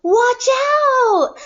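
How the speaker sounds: high, loud cry of warning; alarmed warning. Acted. Reading the fox scene to the class, a high loud warning. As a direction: shout it to warn the ducklings.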